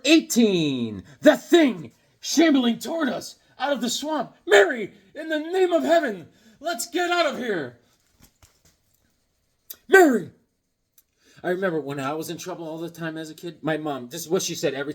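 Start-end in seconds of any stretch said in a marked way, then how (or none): 4.5-7.8 much higher, hammed-up pulp horror voice; dramatic comic hero. A comic hero warning Mary to flee, high and hammed-up. Reading a comic's dialogue aloud. 9.9-10.3 loud, high call. Acted. Comic hero calling after Mary, loud.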